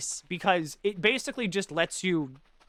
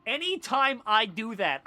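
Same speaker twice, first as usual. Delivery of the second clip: higher, slower earnest hero voice; wholesome hero voice; 7 semitones higher, slower than usual. Shown as a demonstration. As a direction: say it like a saccharine do-gooder. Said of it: "Anytime I talk like that".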